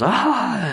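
high yelp of a woman struck by power; mock yell. Healed woman crying out as power hits her, high yelp. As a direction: let out a sudden high cry. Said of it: "And she yells".

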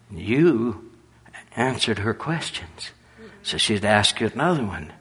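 ordinary talk, nothing marked

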